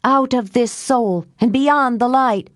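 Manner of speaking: high, intoned spell casting; solemn high-pitched incantation; ritual incantation. A narrator's reading. Nell continues the banishing spell.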